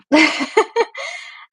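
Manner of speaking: high, loud burst of laughter; self-amused laugh. Real, not acted. Host admits a long-winded answer, self-amused laugh. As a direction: laugh at your own rambling.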